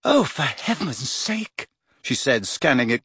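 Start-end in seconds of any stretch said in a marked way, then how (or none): 0.0-1.7 high, slow exasperation; exasperated. A narrator's reading. Girl scans her friend's tearful note, exasperated. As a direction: draw it out in high-pitched disapproval.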